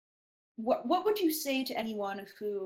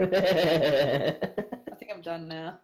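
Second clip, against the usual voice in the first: laughing; 4 semitones lower, 5 dB louder, much slower than usual. Real, not acted. Cracking up at Superman joke.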